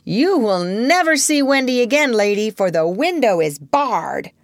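put-on boy's exultant taunt through the window; exultant cry. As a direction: crow it over her in triumph. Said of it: "he cried exultantly".

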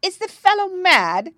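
high, loud, outraged bawl. A narrator's reading. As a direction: bawl it out like an indignant king. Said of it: "bawled the king".

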